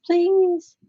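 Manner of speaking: very high, loud wheedle; playful pleading. Pretend. Crafter begs for chocolate Buckeyes, playful high pleading. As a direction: beg for it in a silly high voice.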